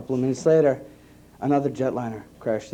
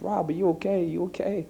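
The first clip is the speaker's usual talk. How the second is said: mild imitated crying wife; 7 semitones higher than usual. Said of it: "she was crying on the other end".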